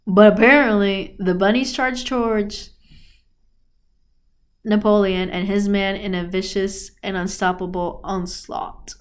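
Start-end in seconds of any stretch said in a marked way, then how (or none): none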